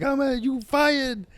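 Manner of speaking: high, slow cartoon boss; puppet boss voice. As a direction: bark it like a silly puppet boss. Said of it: "like the boss being like".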